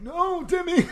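high-pitched mock protest; mock distressed cry. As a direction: cry it out in playful alarm. Mock old-timer's quote in banter.

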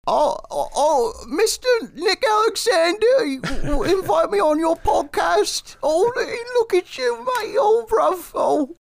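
high, loud hammed-up British accent; a cheeky Cockney-style put-on; mock British accent. [name] greeting Nick in a hammed-up British voice. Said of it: "British voice you just did".

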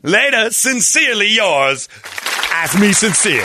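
high, slow showy put-on voice; hammed-up swaggering exit; mock cool guy sign-off. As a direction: drawl it like a smug mic drop. Playing Sincere mic drop.

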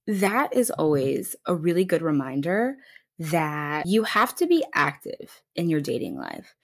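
usual speaking voice

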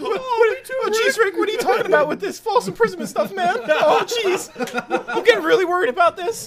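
very high worried Morty voice. Acted. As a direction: squeak it anxiously, in character. Morty impression continued.

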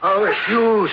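high, breathless oh-oh-oh, easing into relief; panicked gasps. Acted. Uncle startled at the cliff edge, panicked then relieved. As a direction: gasp in shock, then recognise the friend.

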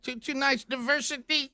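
hammed-up sideline reporter read; Sergio Dip impression. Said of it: "Sergio Dip do the Saint, uh, pass interference".

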